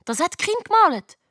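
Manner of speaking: high, excited call. A narrator's reading. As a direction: exclaim it eagerly, pitched high. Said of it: "ruft der Leo".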